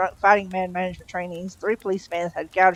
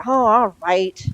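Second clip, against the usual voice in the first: wretched; 3 semitones higher, 6 dB louder, much slower than usual. A narrator's reading. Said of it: "said Crowley wretchedly".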